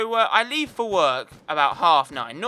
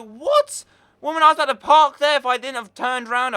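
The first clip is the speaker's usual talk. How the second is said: high, loud, fast indignant outburst; incredulous shout at the driver; outraged; 8 semitones higher, 5 dB louder than usual. Retold or imitated. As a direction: blurt it out as if robbed of the space. Lady steals his parking space.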